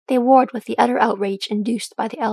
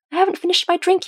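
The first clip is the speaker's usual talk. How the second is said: put-on high-pitched young complaint; complaining; 9 semitones higher, 4 dB louder than usual. A narrator's reading. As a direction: whine it a little, pitch raised. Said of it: "he complained".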